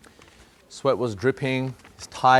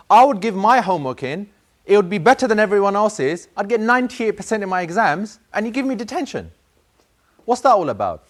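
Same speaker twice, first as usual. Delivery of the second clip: high, loud aggrieved complaint; boy complaining; 6 semitones higher, 5 dB louder than usual. Retold or imitated. Boy before Allah, high indignant complaint. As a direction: pour out the unfairness, higher and louder.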